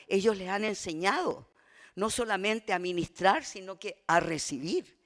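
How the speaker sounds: loud impassioned pulpit preaching; preaching at full force. Acted. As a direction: preach it out to the congregation.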